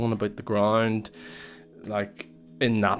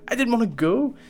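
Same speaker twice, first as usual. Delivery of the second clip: much higher, whiny retold protest; indignant insisting; 12 semitones higher, 4 dB louder than usual. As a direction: dig in, hammed up. Insisting to dad in retelling.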